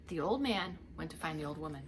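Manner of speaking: ordinary delivery, nothing marked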